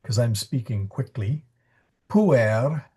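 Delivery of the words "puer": Puer with a trilled, rolled R. Shown as a demonstration. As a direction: roll the R in Puer firmly. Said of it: "R roll in there".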